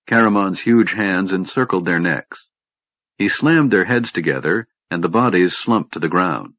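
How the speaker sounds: usual speaking voice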